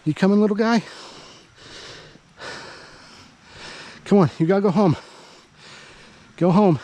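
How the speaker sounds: high, coaxing voice for a stray dog. Real, not acted. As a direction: coax the little dog to go home.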